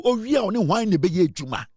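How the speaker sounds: ordinary delivery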